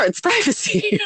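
laughing. Retold or imitated. Mock child rule, laughing.

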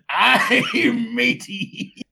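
pirate voice. Acted. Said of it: "the performance".

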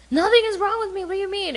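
high, loud offended retort; mock indignant. Husband snapping back at his wife as the argument escalates. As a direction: bristle back defensively.

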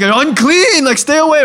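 high, loud alarmed cry to keep someone off; mock alarm. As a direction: shoo away a stranger, half in jest. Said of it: "You're like".

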